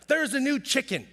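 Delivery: mock panic at a new chicken; panicked alarm. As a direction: blurt it out in a panic. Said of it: "I just freak out about things".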